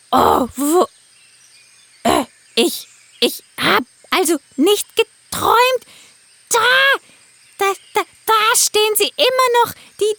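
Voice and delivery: high, stammering bird voice waking up; a parrot coming to; dazed parrot. Acted.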